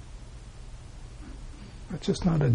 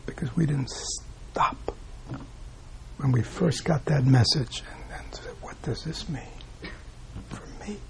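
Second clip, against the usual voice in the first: hushed whisper; 3 semitones lower, 11 dB quieter than usual. Real, not acted. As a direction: lean in and whisper it. Teacher drops to emphatic hush.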